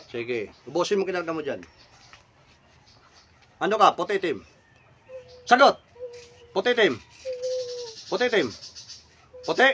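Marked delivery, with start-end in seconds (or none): 5.4-5.8 sharp, loud, high shout; barked demand. Real, not acted. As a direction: demand an answer at once. Demands answer from the spirit.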